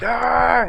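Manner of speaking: sharp high yell underwater; warning scream. Retold or imitated. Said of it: "I'm all screaming at him".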